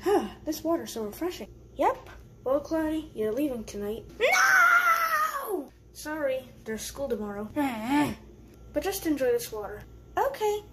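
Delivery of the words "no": high wailing no; a child shouting no; protesting shout. Acted.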